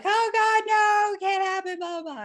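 high, loud mock whining; whiny. Submissive protesting orgasm denial to master, whiny mock dismay. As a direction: whine it in exaggerated, high-pitched protest. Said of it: "was all whiny and".

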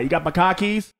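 Southie-style question without Rs; mock Boston accent. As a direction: ask it in a put-on Boston accent. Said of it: "Boston accent is hard".

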